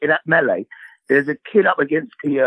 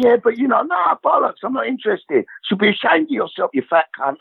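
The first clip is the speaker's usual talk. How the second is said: mock rant; 8 semitones higher than usual. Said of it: "I kept saying to him".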